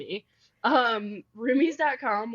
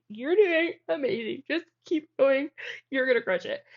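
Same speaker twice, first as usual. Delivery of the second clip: forced cheerful encouragement; 6 semitones higher, 4 dB louder than usual. Retold or imitated. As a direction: cheer her partner on while crying inside. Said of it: "I'm like".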